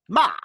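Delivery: ma with a sharp downward drop; falling tone. Shown as a demonstration. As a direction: snap ma down hard. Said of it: "Sing along at home".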